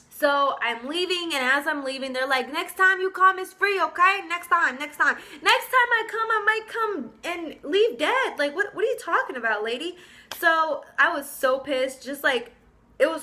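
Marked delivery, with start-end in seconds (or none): 2.5-5.2 high, loud mimicked sales promise; placating salon worker. Salon staff promising departing customer a free visit, mock placating. 5.4-10.0 high-pitched, incredulous comeback; outraged retort. Retold or imitated. Customer answering the offer of a free visit, incredulous.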